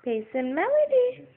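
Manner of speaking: high sing-song call. Real, not acted. Parent calling baby by name in high sing-song. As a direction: call her name in a lilting high tune.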